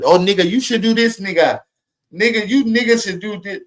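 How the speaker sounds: high, mocking voice of a preachy outsider; mocking impression. Mocking the immigrant who lectures us, high and sneering. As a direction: sneer it up high like a know-it-all.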